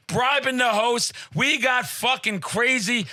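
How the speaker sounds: ordinary talk, nothing marked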